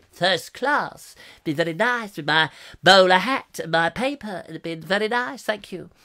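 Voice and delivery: put-on pompous first-class gent; Sir Richard Beeching. Railway boss relishing first-class travel, pompous. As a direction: play a self-satisfied railway toff. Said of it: "'cause I'm Sir Richard Beeching".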